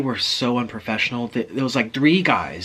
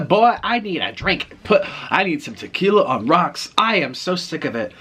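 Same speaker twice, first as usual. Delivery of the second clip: higher, emphatic mock griping; fed-up griping; 6 semitones higher, 4 dB louder than usual. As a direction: imitate a fed-up worker moaning to colleagues. Fed-up clerks griping to each other.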